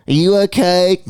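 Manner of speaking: lightly mocked sleepy wife; sleeping wife. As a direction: mimic a wife half-asleep checking in. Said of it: "my poor wife's asleep going".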